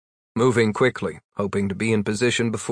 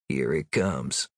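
resigned mutter; 10 semitones lower, 7 dB quieter, slower than usual. Acted. Gary dreading, low.

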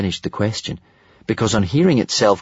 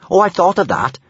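higher, louder breezy assurance; confident; 9 semitones higher, 5 dB louder than usual. A narrator's reading. Kamikaze has a plan for the maze, confidently. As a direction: answer brightly, sure of yourself. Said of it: "more confidently this time".

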